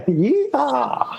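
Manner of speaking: high, whooping joke cowboy holler; mock cowboy yeehaw. Brit in Tulsa hams up a cowboy yeehaw for the host. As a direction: throw out a hammed-up Oklahoma yeehaw.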